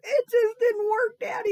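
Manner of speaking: high, loud sobbing; crying child. Acted. Nancy sobbing to Daddy about the cake, high and loud. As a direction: sob it out like a heartbroken kid. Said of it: "heard all that commotion".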